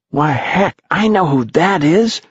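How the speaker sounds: put-on breathless kid after running; out of breath. A narrator's reading. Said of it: "panted Henry Hank".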